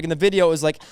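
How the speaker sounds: usual speaking voice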